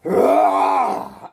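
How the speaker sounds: low loud roar; growl. A narrator's reading. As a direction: growl as power surges. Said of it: "And he goes".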